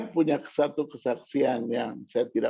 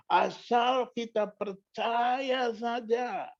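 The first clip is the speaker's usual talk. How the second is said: "percaya" drawn out long and high; emphatic preaching; 8 semitones higher, much slower than usual. Real, not acted. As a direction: stretch "percaya" slowly, pitched up. Preacher drives home the point.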